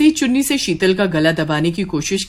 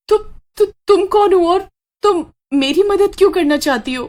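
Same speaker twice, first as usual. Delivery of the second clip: much higher, stuttering in fear; terrified stammer; 11 semitones higher, 3 dB louder than usual. Acted. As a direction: stammer out the question, shaking with fright. Sheetal stammers facing the witch. (डर से हकलाते हुए)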